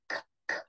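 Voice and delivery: "k, k" as a soft unvoiced click with no vowel; k sound. Shown as a demonstration. Teacher to class, isolating the k sound. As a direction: breathe out a bare k twice, no uh after it. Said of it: "begins with the k".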